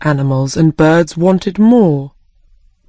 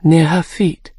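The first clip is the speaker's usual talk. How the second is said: usual speaking voice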